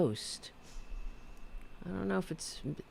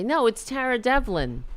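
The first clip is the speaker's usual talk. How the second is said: pretending on the phone; 8 semitones higher, 4 dB louder than usual. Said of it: "pretend to be Tara Dublin".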